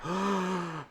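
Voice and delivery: soft gasp into an airy ah; relieved gasp. Retold or imitated. Retelling the moment the chest opened up, relieved gasp. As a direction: breathe out in sudden relief. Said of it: "I was like".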